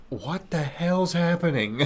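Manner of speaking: slightly high, drawn-out mock disbelief; retold bewilderment. Explorer retelling finding kids' toys out back, mock bewilderment. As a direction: stretch it out, imitating baffled shock.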